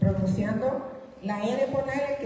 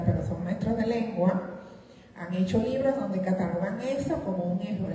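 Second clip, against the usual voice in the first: ordinary talk, nothing marked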